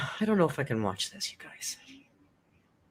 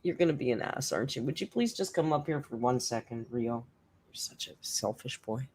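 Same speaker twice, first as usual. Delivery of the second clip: mild scolding the cat; 3 semitones lower, 8 dB quieter than usual. Real, not acted. Scolding the cat that won't come.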